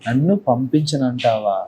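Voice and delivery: very slow, low menacing questions; psycho. Acted. Guest acts psycho for the host over Bigg Boss, slow low menace. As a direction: play an unhinged villain, dragging each threat out. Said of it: "psycho".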